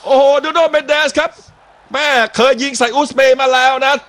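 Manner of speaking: excited play-by-play. Real, not acted. Commentator calls the corner for viewers, excited play-by-play. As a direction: call the near miss off the bar.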